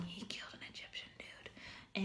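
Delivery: whispered confidential aside. Real, not acted. Teacher whispering to viewers about a killing. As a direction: hush it like a scandal.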